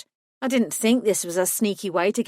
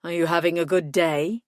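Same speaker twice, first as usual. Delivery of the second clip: male lover voice. Acted. Lucas voiced lower.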